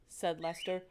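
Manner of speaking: ordinary delivery, nothing marked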